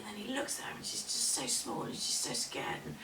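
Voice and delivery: plain reading voice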